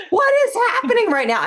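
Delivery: high, loud bewildered cry; incredulous outburst. Retold or imitated. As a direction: cry out in total disbelief. Retold shock at psychic caller.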